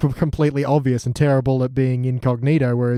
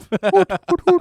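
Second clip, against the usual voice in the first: owl hoots. Pretend. Proposing hooting instead of booing, rising mock hoots. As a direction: hoot like a jeering crowd, climbing higher. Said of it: "I say hoot".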